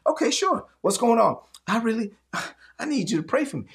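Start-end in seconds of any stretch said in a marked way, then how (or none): 1.7-3.6 weary plea with a sigh; a hint of a tired churchgoer; sighing congregant. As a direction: sigh before asking for prayer.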